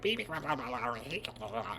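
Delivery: jabber like a little goblin creature; goblin gibberish. Retold or imitated. Goblins jabbering at Luke, goblin gibberish.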